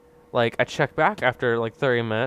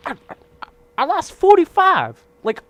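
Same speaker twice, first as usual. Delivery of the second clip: disbelief; 11 semitones higher, 5 dB louder than usual. Real, not acted. Gamer vents to viewers in loud, high shouting disbelief. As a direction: cry out at losing the subscribers. Outraged at lost subscribers.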